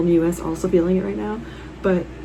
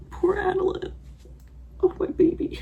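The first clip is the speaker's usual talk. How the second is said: high, soft, whispered pity; whispered grief; 12 semitones higher, 6 dB quieter than usual. Real, not acted. Reader grieving a character's horse, high whispered pity. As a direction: mourn it under your breath.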